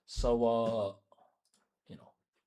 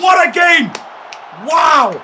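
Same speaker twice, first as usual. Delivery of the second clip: high, loud thrilled shout; excited yelling; 13 semitones higher, 14 dB louder, slower than usual. Real, not acted. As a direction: yell in pure amazement. Reacting to the equaliser.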